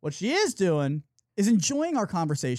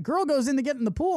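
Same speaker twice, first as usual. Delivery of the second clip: ordinary talk, nothing marked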